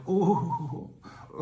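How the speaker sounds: high, drawn-out mock German oh; hammed-up German oh of delight; turned-on German enforcer. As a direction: moan it like rules thrill you. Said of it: "You're turned on by enforcing rules".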